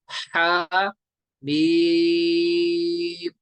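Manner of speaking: "हा" brief, "मीम" held very long and strong; madd recitation. Shown as a demonstration. Teacher reciting Ha Meem for students, drawn out. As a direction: sustain the ee of "मीम" on a level note. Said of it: "पढ़ेंगे".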